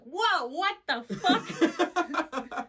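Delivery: high, loud mock-startled reaction; startled outburst breaking into laughter; retold shock. Retold shock at brother's deep voice.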